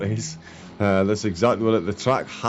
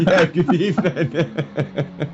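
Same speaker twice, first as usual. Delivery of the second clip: slow, laughing greeting; 7 semitones higher, much slower than usual. Real, not acted. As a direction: laugh through the words. Laughing through own greeting.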